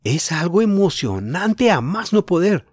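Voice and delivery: put-on boy bursting with news; thrilled. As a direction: draw out the excitement.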